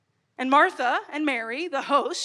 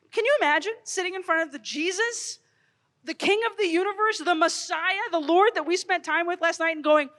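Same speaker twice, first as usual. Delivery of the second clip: usual speaking voice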